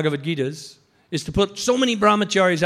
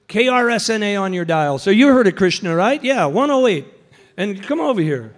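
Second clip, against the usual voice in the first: loud Krishna radio station patter; punchy, projected on-air call; radio announcer; 5 dB louder than usual. Acted. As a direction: pitch it to the crowd like a station host. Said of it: "giving this Krishna radio station".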